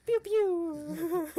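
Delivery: very high, loud "pew, pew"; squeaky imitation gunfire; mouthed gunshots. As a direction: squeal out toy laser shots.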